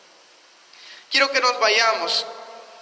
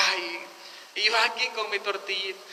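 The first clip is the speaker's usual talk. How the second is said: mock self-pity; 2 semitones lower, 9 dB quieter than usual. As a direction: lament it quietly, acting hard done by. Mimics envious person with humble meal. (lamento fingido, bajito y resignado)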